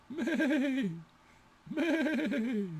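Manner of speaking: high sheep bleat. Shown as a demonstration. Teacher mimicking a sheep for students, high bleat.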